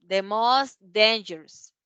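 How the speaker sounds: The most handsome said slowly, word by word; slow model phrase. Shown as a demonstration. Teacher modeling superlative for students, slow and clear. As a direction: spell out The most handsome for learners. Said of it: "The most handsome".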